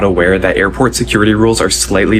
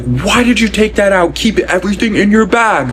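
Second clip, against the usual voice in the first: high-pitched, drawn-out mock scolding; flipping out; 11 semitones higher than usual. Imitating TSA agent scolding her over a laptop, flipping out. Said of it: "this T S A agent flips out at me".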